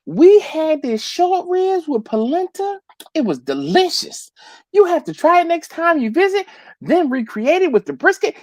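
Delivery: imitating a Southern Belle accent, high and sweet. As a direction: do your friend's Southern Belle voice. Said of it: "I could hear her saying".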